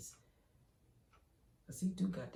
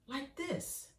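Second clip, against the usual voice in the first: eerie father reply; 10 semitones higher than usual. Retold or imitated. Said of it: "he was like".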